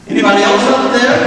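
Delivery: high, loud call upward; hopeful shout. Retold or imitated. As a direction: shout it up the mountain, hoping for another answer. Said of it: "He shouts out again".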